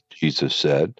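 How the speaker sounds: ordinary talk, nothing marked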